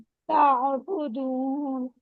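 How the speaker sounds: ताबुदून slow, the ain sound flowing on. Shown as a demonstration. Teacher corrects sister's ताबुदून, ain flowing smoothly. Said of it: "आइन के बाद चलती हुई feel होगी".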